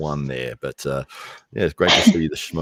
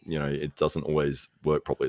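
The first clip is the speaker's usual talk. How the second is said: normal talking voice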